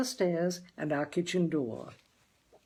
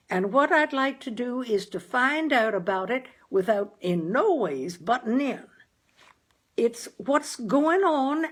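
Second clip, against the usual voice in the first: raised high and loud, careful and formal; father speaks stiffly and loudly to Dan; loud phone voice; 6 semitones higher, 4 dB louder than usual. A narrator's reading. Father shouting on early telephone.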